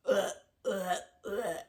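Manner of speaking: repeated retching "ugh" of disgust. Retold or imitated. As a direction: imitate gagging at a foul taste. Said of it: "that's how bad it tastes".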